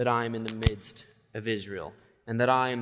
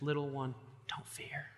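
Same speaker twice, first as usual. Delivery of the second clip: softer, gentle reassurance; God comforting his little one, hushed and tender; God's tender comfort; 2 semitones higher, 8 dB quieter than usual. Retold or imitated. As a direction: soothe a frightened child. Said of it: "God says to us".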